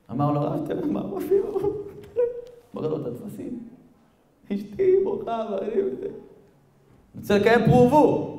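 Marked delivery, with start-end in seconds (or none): 0.4-1.7 high, tearful plea; choked, high-pitched sobbing imitation. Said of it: "מתחיל לבכות". 4.5-8.3 high, weepy pleading; acted crying, voice pitched up; tearful. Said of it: "מתחיל לבכות".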